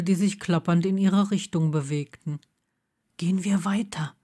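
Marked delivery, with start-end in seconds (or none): 3.2-4.1 soft unvoiced whisper; whispered warning to move on. A narrator's reading. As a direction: hush it so nobody hears. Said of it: "sagte Blaze leise".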